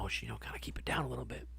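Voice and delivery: hushed, voiceless whisper; retold whisper. Hushing his friend so the sleeping girlfriend won't hear, in a whisper. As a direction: whisper it so nobody in the next room wakes.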